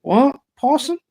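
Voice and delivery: high, loud incredulous questions; mock-astonished yelp, rising; baffled disbelief. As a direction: re-enact being totally thrown by the request. Acts out his baffled reaction.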